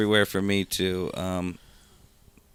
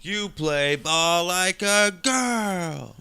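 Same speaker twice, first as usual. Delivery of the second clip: higher, very slow schoolyard taunt; Sandlot kid taunt; 8 semitones higher, much slower than usual. Retold or imitated. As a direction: drawl it like a bratty kid. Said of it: "That's from Sandlot".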